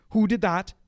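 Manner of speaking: loud, high demanding question; the sports officer demanding who did it, loud and high; angry teacher demand. Retold or imitated. Sports officer confronting the boys.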